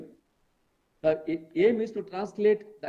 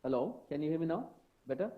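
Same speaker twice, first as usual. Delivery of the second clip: barely audible; 8 dB quieter than usual. Real, not acted. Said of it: "You'll have to come closer".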